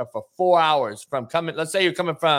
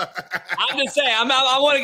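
laughing; 8 semitones higher than usual. Real, not acted. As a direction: chuckle while pushing for an answer. Laughing while asking Smitty.

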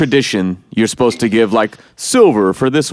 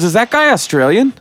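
higher-pitched imitation of a puzzled viewer; rising, quizzical retold question; 9 semitones higher than usual. Host quotes his wife spotting an odd accent, retold puzzlement.

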